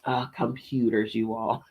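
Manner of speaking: ordinary delivery, nothing marked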